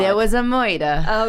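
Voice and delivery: put-on slow, old-time New York gangster; mobster murderer voice. Explaining her accent to the co-host, slow mobster voice. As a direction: play a hammed-up forties mobster. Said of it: "my mobster murderer voice".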